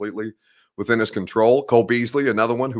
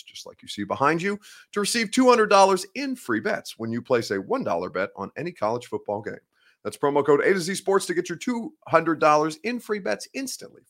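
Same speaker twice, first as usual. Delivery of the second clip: ad read; 4 semitones higher than usual. Acted. Reads sponsor ad.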